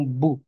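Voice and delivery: "bou" sounded as one syllable with an ou vowel; slow teaching syllable "bou"; demonstrated syllable sound. Shown as a demonstration. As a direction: model the sound "bou" for learners. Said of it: "ça donnera le son".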